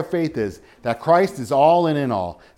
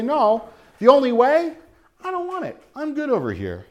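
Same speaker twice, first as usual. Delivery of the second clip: high-pitched, brush-it-off refusal; mock dismissive refusal; 9 semitones higher than usual. Sinner turning down salvation, mock dismissive. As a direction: wave the offer away, voice jumping up high.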